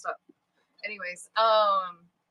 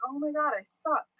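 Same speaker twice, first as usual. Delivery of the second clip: pouty whine; 5 semitones higher than usual. Retold or imitated. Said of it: "I get pouty and I'm like".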